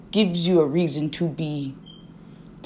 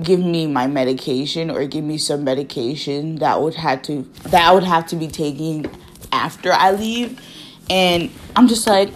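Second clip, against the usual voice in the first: normal talking voice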